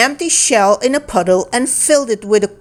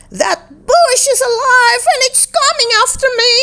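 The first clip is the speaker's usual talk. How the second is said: panicked; faster than usual. A narrator's reading. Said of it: "screamed Shay".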